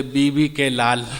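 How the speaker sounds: stranger's reply. Retold or imitated. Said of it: "बोले".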